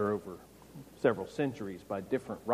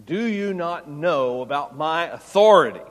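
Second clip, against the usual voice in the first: Pilate's boast; 3 semitones higher, 8 dB louder than usual. Retold or imitated. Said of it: "Pilate begins by saying".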